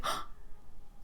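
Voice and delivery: sudden audible gasp of realization; acted gasp. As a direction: draw in a sharp breath, re-enacting surprise.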